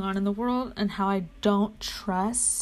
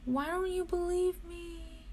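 mock whine; 5 semitones higher, 7 dB quieter than usual. Pleading with the website.

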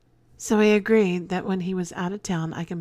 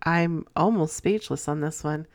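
usual speaking voice

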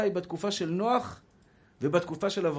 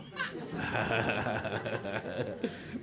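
a long amused laugh; a hearty laugh, breaking the sermon; real laughter; 8 semitones lower, 8 dB quieter than usual. Real, not acted. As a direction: laugh openly at the joke. Laughs at own joke.

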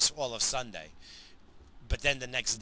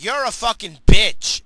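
slow, furious shout, much louder and higher; exasperated yell; 8 semitones higher, 17 dB louder than usual. Retold or imitated. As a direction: blast it at the whining kid. Father yelling at whining son.

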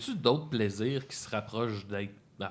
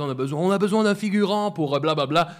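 higher, louder mock crew call; raised voice, quoting the crew; retold call-out; 3 semitones higher, 6 dB louder than usual. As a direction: imitate a crew member calling for an extra. Retelling crew calling for extra.

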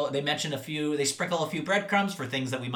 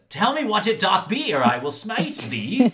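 put-on loud, high, thundering old-English threat; mock biblical prophet; 9 semitones higher, 6 dB louder than usual. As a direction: smite them like an Old Testament prophet. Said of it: "mock old-English threat".

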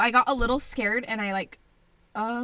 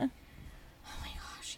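hushed; 19 dB quieter, much slower than usual. Real, not acted. Recalling postpartum fear.

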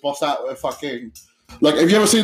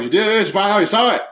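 put-on rapid thick-accented gabble; 4 semitones higher, 4 dB louder, faster than usual. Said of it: "But he says it real fast".

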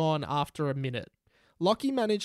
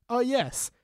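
ordinary delivery

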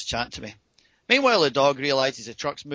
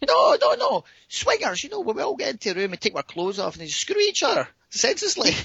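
imitated insistent woman; 10 semitones higher than usual. Woman correcting his guess of dancers, imitated high exasperated explanation. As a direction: spell it out impatiently, pitched way up.

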